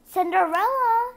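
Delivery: high loud put-on shriek. Stepmother shrieks for Cinderella, high and loud. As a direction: shriek the name upstairs. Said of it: "shrieked her stepmother".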